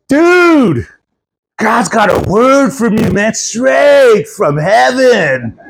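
big stoked surfer proclaiming a revelation; hippie surfer pastor. Retold or imitated. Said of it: "the hippie surfer".

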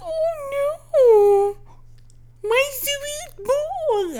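high, slow wailing dismay; mock dismay. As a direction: wail it like a heartbroken pet parent. Dismayed about gentle cat.

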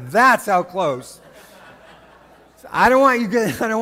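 loud, high, hammed-up amazement; imitated excited teenager. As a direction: breathlessly report wild news like a mock teenager. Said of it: "like, teenager".

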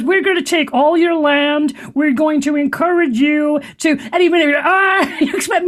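mock Kermit impression; Kermit-like Bill Gates. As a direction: squeak it like a Muppet frog. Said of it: "he sounds like Kermit the Frog".

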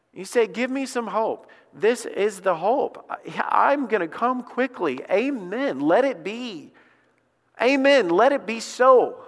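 high-pitched, rising fervor building to a loud "Amen"; impassioned preaching. Real, not acted. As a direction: preach it out with mounting urgency. Preaches the hope at full force.

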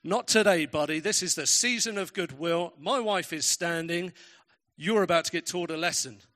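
loud, mock-stern scolding; indignant telling-off. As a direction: confront him loudly, righteously put out, in retelling. Imagined telling-off of seated man.

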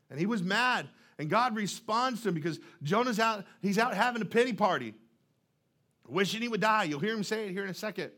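ordinary delivery

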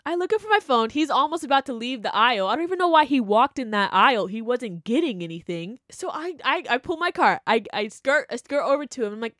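ordinary talk, nothing marked

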